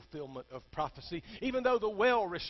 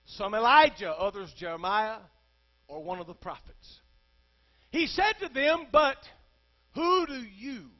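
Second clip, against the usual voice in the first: loud, high sermon voice building on each question; fiery pulpit delivery; preaching at full force; 6 semitones higher, 8 dB louder than usual. Acted. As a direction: thunder it out to the congregation. Preacher retells Jesus' question.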